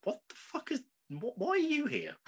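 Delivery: high, startled disbelief; shocked. Retold or imitated. Fan retelling his reaction to the president, high mock shock. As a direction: pitch up in mock shock with a laugh. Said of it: "I was like".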